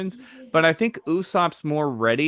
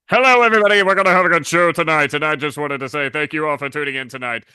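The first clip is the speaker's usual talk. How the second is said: All Might as Conan O'Brien; 6 dB louder, much faster than usual. Pretend. Voicing All Might hosting the show, booming. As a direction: boom out a cheery show opening. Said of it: "let's start with the easiest one I can do. Let's do All Might".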